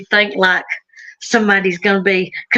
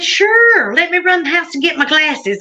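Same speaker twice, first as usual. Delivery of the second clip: much higher, bright little-lady voice; sweet lady; 6 semitones higher than usual. Retold or imitated. The woman at the house agreeing to look, high and sweet.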